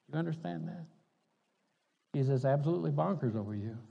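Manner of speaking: ordinary talk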